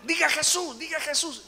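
high, loud repeated urging; retold exorcist's cry, urgent and high; insistent command. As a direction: shout the order over a demon's protests. Retold insistent commands to possessed woman.

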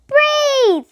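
high, loud shouted breathe; group shout. A narrator's reading. Whole class shouting after Stephen, loud. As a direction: shout it all together. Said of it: "the entire class shout together".

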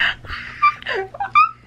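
very high, loud gasp; high squealing gasp. Real, not acted. Reader sees the kiss, squealing gasp.

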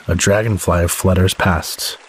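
ordinary delivery

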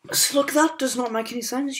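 high, loud protest; real indignant protest; frustrated outburst. Real, not acted. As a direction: complain loudly at the screen. Frustrated at the game.

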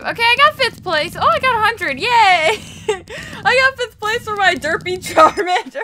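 high, loud, laughing celebration; excited squeal. Real, not acted. Gamer celebrates placing fifth with her Charmander, high and giddy. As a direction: squeal with delight at the result.

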